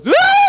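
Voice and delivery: a very high, very loud shriek; imitating the wife's shriek from the bathroom; crazy death scream. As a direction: shriek as if something awful happened. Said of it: "It was like this crazy death scream".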